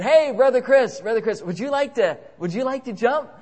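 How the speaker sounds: high, loud mock hail to a buddy; eager calling out. Crazy guy coaxing Brother Chris to jump, eager high call. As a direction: imitate a pal calling out to coax someone.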